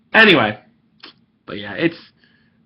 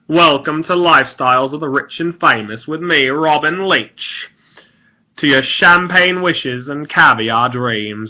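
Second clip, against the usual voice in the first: grand, drawn-out TV host impression; Robin Leach; 3 semitones higher, 4 dB louder, slower than usual. Shown as a demonstration. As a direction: announce it with stretched-out final words. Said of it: "I do a very good Robin Leach".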